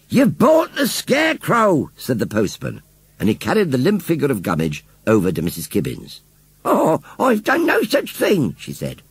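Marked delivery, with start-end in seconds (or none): 0.1-1.9 slow, high-pitched calling out; emphatic repeating. A narrator's reading. Postman repeats the news to Missus Kibbins, emphatically. 6.6-8.5 slow, high, drawn-out protest; indignant old lady. A narrator's reading. Missus Kibbins denies buying the scarecrow, indignant.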